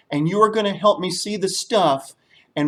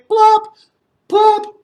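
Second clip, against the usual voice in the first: very high loud popping plop; sound-effect plop; 8 dB louder than usual. Shown as a demonstration. Teacher leading the children in a loud high plop. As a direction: pop it like a stone in water. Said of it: "we're all going to say".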